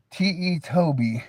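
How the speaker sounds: ordinary talk, nothing marked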